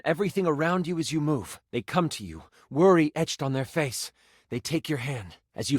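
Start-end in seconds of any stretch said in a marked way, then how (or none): none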